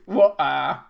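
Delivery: "water" with no T sounded; mock-Cockney "water", gap for the T; dropped T. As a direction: leave out the T in "water". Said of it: "she doesn't say the T".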